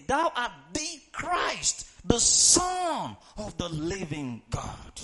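slow shouted declaration of faith; slow booming proclamation, building to a shout on living God; proclaimed loud. Shown as a demonstration. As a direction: shout it out slowly, full conviction. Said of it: "That's why I ask you to say that word".

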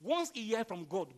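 ordinary talk

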